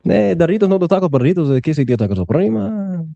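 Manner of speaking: high-pitched mock Spanish accent. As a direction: fake Spanish in a silly high voice. Teasing with mock Spanish gibberish.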